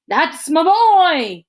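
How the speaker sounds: high, loud and slow cheer; dad cheering his son on loudly; proud dad shout. A narrator's reading. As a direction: shout it from the stands. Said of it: "my dad shout".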